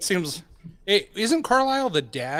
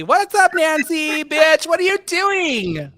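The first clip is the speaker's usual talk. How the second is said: higher and louder, sassy gushing; campy gushing voice; 11 semitones higher, 7 dB louder than usual. Acted. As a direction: squeal it like a campy host.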